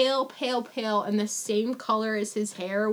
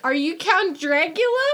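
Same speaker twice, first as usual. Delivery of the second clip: higher, louder questioning; imitating Harker's high question; mock Harker question; 8 semitones higher, 8 dB louder than usual. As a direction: play the eager guest asking. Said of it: "He's like".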